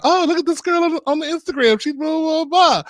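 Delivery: high, squealy mimicry of her own chatter; high mocking imitation of excited small talk; mock gushing. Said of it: "talking about, 'Oh".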